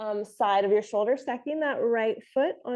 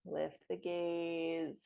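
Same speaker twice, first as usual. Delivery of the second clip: slow, hushed, lower voice; soft yoga cue; 3 semitones lower, 10 dB quieter, slower than usual. Real, not acted. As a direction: let the words sink with the exhale. Cueing last slow breath movement.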